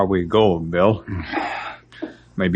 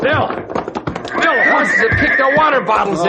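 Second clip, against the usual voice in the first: alarmed shout. Acted. To Bill after the horses wreck the water, a high alarmed shout. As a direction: call out the disaster. Reporting disaster amid chaos.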